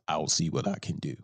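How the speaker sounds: dismissive mumble of a stock excuse; mocking empty promise. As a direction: drop low and soft, brushing it off.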